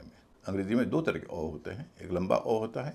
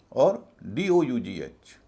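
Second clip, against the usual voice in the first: normal talking voice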